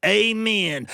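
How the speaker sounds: high, drawn-out folksy prayer chant; stretched, high-pitched praying voice; mock prayer. A narrator's reading. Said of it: "when he got into his prayer".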